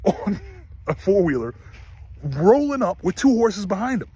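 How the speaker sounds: usual speaking voice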